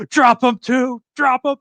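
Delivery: very high, frantic commentator call; excited announcer. Retold or imitated. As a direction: urge it on like a ringside announcer. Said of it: "And he goes".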